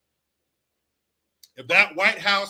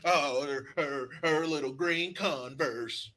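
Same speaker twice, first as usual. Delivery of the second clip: mocking tearful speech; 5 semitones lower, 7 dB quieter, slower than usual. Mocking McConaughey's emotional speech.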